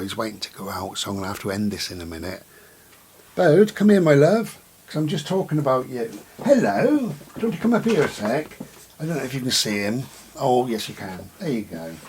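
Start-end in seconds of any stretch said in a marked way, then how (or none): 3.3-4.6 much higher, louder pet-calling voice; calling the dog. Real, not acted. Calling her dog over. 6.4-8.6 calling the dog. Real, not acted. Talking to her dog.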